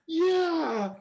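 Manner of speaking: very high, long loud imitated yell; yelled yes. Driver agreeing to hug the man, yelling. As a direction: holler an all-in acceptance. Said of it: "we're still kinda yelling".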